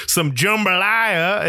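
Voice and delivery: slow, drawn-out Southern "jambalaya"; hammed-up Southern accent. Shown as a demonstration. Mocking a movie accent with a hammed-up Southern drawl. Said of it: "go real Southern on".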